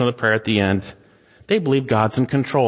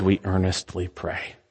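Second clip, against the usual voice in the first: hushed prayer; 8 semitones lower, 6 dB quieter than usual. Shown as a demonstration. Prayer repeated very softly.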